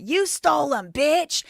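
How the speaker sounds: loud, high accusing yell; mock angry yell. Josh accusing Ben over the Legos, loud mock yell. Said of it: "He did say that".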